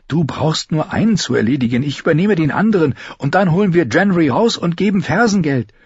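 high, urgent character voice; high, pressing and determined; insistent young Arya. A narrator's reading. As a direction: talk a scared friend into the plan.

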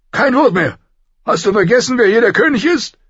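furious king. Acted. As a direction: shout him down as the king. Robert furious at Ned. (wütender König schreit)

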